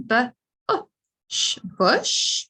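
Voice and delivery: "bush" split into b, uh, sh, then blended; sounding out. Shown as a demonstration. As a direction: segment "bush" slowly, then say it whole. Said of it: "It's making another uh sound".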